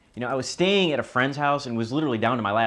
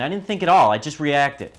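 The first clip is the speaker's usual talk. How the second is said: agitated outburst; 5 semitones higher, 9 dB louder than usual. Acted. Desperate son recounting the fight.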